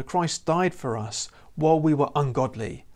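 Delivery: ordinary delivery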